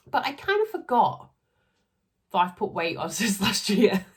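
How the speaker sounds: high, loud, laughing admission; amused, high-pitched confession breaking into laughter. Real, not acted. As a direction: own up to it through a laugh. Laughing confession about weight gain.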